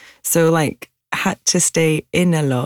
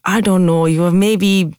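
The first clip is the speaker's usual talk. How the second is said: put-on light Pakistani accent, a bit louder; 4 dB louder than usual. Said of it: "do a Pakistani accent".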